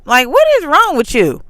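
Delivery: heated rhetorical scold; exasperated demand. Retold or imitated. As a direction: demand it, out of patience. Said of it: "I didn't say, like".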